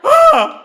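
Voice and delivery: high, loud comic shriek of panic; panicked scream. Retold or imitated. Boy who crushed his dad's hand, loud panicked shriek. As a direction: let out a shrill mock scream.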